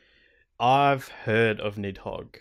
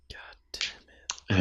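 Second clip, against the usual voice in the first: slow, whispered curse after a sigh; 3 semitones lower, much slower than usual. Real, not acted. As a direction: let it out on a weary breath. Sighing at the sad story.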